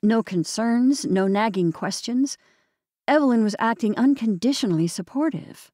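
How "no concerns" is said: ordinary delivery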